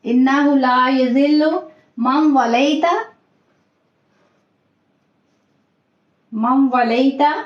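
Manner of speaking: slow melodic recitation drawn out. Shown as a demonstration. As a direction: recite slowly for students to repeat. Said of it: "teacher models line". (धीमी तिलावत)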